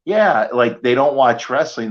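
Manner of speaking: usual speaking voice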